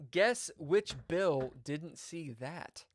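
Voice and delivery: ordinary delivery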